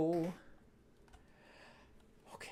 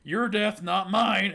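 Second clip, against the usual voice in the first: higher, louder scoffing king, laughing through it; a king laughing off a threat, high and loud; mock king taunt; 4 semitones higher, 5 dB louder, much slower than usual. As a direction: scoff it down at him like a smug villain. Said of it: "And the king's like".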